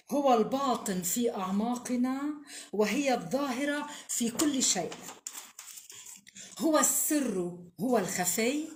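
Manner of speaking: solemn Arabic recital with long pauses; poem recitation. Acted. (إلقاء شعري مهيب)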